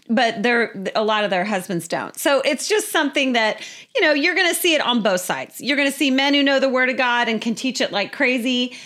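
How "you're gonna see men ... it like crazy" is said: ordinary talk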